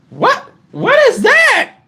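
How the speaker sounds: high, drawn-out mock alarm; imitating a shocked woman; alarmed shriek. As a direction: shriek it in disbelief, acting her out. Acted alarm on coming out.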